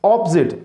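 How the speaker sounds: "ऑपोजिट" as op-zit; correct pronunciation. Shown as a demonstration. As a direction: say "ऑपोजिट" in two syllables. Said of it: "इसे क्या कहेंगे?".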